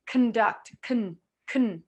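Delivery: "con" as "kn" with hardly any vowel; reduced syllable. Shown as a demonstration. Teacher showing how the syllable sounds, "con" reduced to "kn". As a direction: say "con" in "Conduct" with its vowel swallowed. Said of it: "this is what it sounds like".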